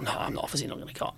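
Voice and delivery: fast, breathless whisper to himself; whispered thought. Retold or imitated. Fan sees John Cleese walk in, whispers to himself. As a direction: hush it like a private panic.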